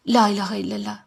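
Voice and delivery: soft exasperated sigh of a phrase; muttered resignation. Acted. Sighing exasperated pious phrase.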